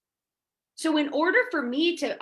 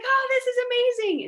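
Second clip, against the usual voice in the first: giddy excitement; 12 semitones higher, 4 dB louder, much faster than usual. Retold or imitated. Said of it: "And I'm like".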